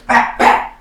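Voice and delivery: two loud, sharp hits; explosive double punch noise; imitated punch sounds. Fool runs up on storyteller, loud imitated double punch.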